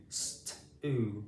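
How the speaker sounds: "St-oo" split into its sounds, the oo stretched; sounding out. Shown as a demonstration. Teacher models sounding out a word for pupils, segmented. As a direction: sound out "St-oo" slowly, piece by piece. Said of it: "practice our pronunciation together".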